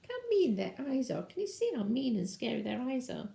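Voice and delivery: usual speaking voice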